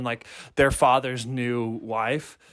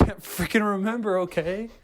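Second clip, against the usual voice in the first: higher-pitched mock exasperation; frustrated retort; 6 semitones higher than usual. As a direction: act out a testy comeback. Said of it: "just that frustration of being together".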